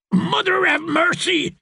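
very high, strained gasp; gasped plea. A narrator's reading. Dornishman run through by her blade, gasping. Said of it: "the Dornishman gasped".

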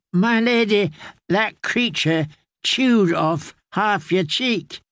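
put-on an old caretaker explaining gently; lightly voiced old man telling the tale; old man's voice. Grey man answers bluntly.

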